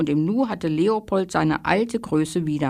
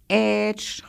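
taunting call; 3 semitones higher than usual. Acted. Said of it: "rief er nach unten".

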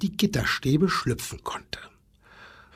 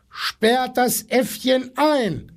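much higher, slow indignant demand; demanding animals; 9 semitones higher, slower than usual. A narrator's reading. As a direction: insist the monkey be locked up. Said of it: "verlangten die Tiere". (hoch, langsam, empört fordernd)

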